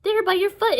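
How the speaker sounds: high, loud frantic pointing-out; urgent yell. Acted. Annabeth spotting the eyeball, urgent yell. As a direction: call it out frantically.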